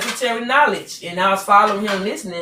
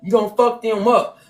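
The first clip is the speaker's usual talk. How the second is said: normal talking voice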